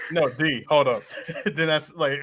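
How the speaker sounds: ordinary delivery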